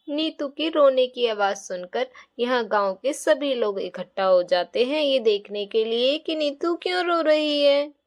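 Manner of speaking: put-on children's story narrator; story narration. A narrator's reading. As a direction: narrate the gathering of villagers.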